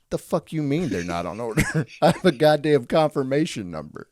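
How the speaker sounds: fed-up customer, half laughing; angry customer. Acted. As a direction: demand it like an outraged customer on the phone. Acted angry customer complaint.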